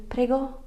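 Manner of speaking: "Prego" lifted high as a question; questioning intonation. Shown as a demonstration. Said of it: "use it with an intonation".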